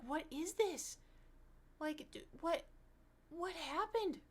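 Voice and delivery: high, bewildered retelling; baffled outburst. Retold or imitated. Retelling her reaction to the Temptation Island memes, high and baffled. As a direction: sound totally lost and confused. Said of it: "I was like".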